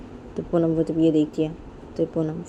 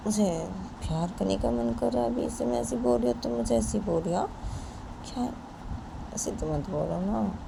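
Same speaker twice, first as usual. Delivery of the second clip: softer, hushed pleading; soft coaxing; 3 semitones higher, 8 dB quieter than usual. Acted. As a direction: murmur to soothe her. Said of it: "तो पूनम पायल को बोलती है".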